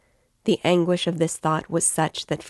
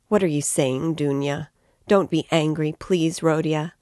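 ordinary delivery

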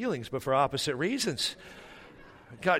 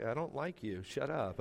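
much softer, sullen under-the-breath reply; grumpy aside; 9 dB quieter than usual. Retold or imitated. Grumpy dad answering friendly churchgoers, soft sullen aside. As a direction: grumble it quietly to yourself.

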